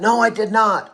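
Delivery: ordinary talk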